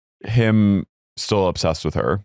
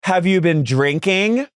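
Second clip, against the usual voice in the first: slow, high, pointed question rising at the end; mock accusing question; 9 semitones higher, slower than usual. [name] asking as the server comes up, pointedly loaded question. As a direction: draw it out high, a nosy mock interrogation.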